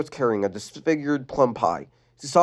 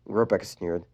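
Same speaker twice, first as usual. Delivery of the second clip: ordinary delivery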